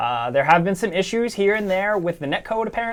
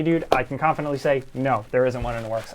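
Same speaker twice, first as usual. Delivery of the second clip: normal talking voice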